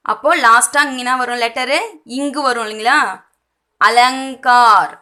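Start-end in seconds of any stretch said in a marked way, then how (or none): 3.8-4.9 "அலங்கார்" slow and loud, a-lang-kaar with ng; slow, emphatic model of "அலங்கார்"; ng for dot. Shown as a demonstration. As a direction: stretch "அலங்கார்" out, sounding ng before k. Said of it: "இங்கு தான் pronounce பண்ணணும்".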